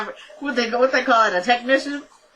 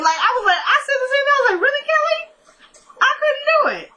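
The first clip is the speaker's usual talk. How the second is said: very high voice breaking into laughter; laughing incredulously; faster than usual. Real, not acted. Host recalling her reaction to the car request, high incredulous laughing. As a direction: squeal it through a real laugh.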